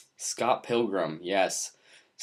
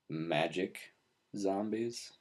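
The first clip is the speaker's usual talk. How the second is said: deadpan disbelief; 3 semitones lower, 11 dB quieter, much slower than usual. Real, not acted. Staring in disbelief.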